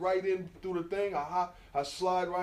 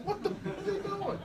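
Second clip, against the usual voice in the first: much higher, laughing mock disbelief; baffled neighbors. Neighbors from the building watching him run the tracks, laughing mock disbelief. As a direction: squeal it like a shocked neighbor, through laughter.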